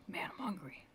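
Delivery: unvoiced whisper to herself. Real, not acted. Artist whispering to herself about being hungry, whispered. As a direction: breathe it out under her breath. Muttering to self while drawing.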